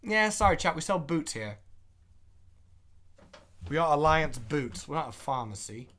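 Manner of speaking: put-on British shopkeeper; British accent. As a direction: play an apologetic British shop clerk. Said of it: "when I try to do a British accent".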